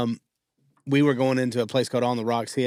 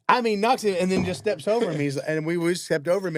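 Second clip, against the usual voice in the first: normal talking voice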